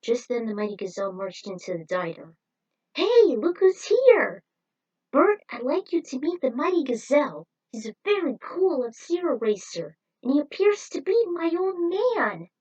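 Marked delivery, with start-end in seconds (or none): 2.9-12.5 excited greeting. Acted. Diner owner proudly introducing Gazelle to Bert, excited. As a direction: announce him like a proud host.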